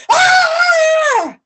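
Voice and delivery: very high, loud scream; wife with frying pan screams; high shriek. Acted. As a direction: scream in shock.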